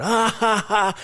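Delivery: loud, high fake "ha, ha, ha"; forced fake laugh. Shown as a demonstration. Soldier laughing through the suffering to keep from quitting, fake laugh. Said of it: "we're all fake laughing".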